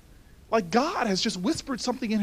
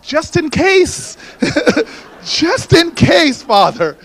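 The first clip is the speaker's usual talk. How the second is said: slow, louder, laughing through it; laughing punchline; 5 semitones higher, 12 dB louder, slower than usual. Real, not acted. Telling Father about the holy books, laughing slowly. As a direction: savor the joke with a laugh.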